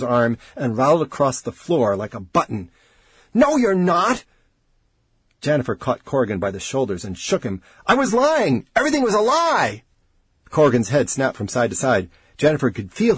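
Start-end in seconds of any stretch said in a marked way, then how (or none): 3.3-4.2 high, insistent protest; desperate denial. A narrator's reading. Researcher arguing with the dying man, desperate. 7.9-9.8 high, frantic confession; desperate cry. A narrator's reading. Researcher shaking the dying man, desperate cry.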